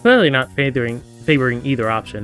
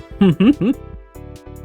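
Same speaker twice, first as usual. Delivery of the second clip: light bubbling laugh; rising giggle; amused giggle; 8 semitones higher than usual. Real, not acted. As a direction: chuckle at your own bit. Laughs after own bit.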